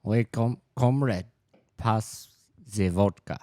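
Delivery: halting, reluctant Russian accent; hesitant amateur Russian accent. Shown as a demonstration. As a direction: try a Russian accent, word by word. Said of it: "do a Russian accent".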